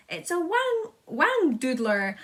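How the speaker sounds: loud, high, slow yell. A narrator's reading. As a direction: bellow the strange name. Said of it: "yelled the head of the fire department".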